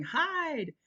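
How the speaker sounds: put-on high, loud child's shout; kid yelling. A narrator's reading. A child shouts out. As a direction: kids warn each other to hide.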